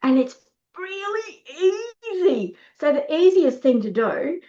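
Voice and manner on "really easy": "really easy" stretched slowly at high pitch; drawn-out emphasis. Real, not acted. Teacher selling the trick, "really easy" high and drawn out.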